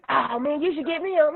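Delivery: high pleading fan; mock fan voice. Imitating fans at him.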